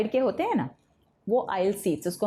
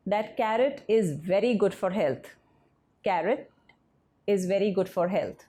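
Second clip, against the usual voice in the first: slow dictation; much slower than usual. Shown as a demonstration. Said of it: "teacher reads the example sentence slowly".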